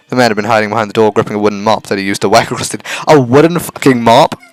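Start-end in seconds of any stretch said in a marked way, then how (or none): 3.1-4.4 laughing, louder and slower; laughing disbelief. Real, not acted. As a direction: crack up in disbelief. Reacts laughing to text.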